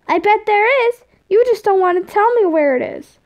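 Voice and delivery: put-on high, whiny kid voice; accusing kid. A narrator's reading. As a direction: accuse like a suspicious child. Tom accusing Tord.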